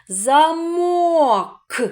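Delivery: "Замок" high and stretched, final К popped separately; detached last sound. Shown as a demonstration. Teacher asks the final sound, К split off "Замок". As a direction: stretch "Замок", pause, release a crisp К. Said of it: "Який звук в кінці слова?".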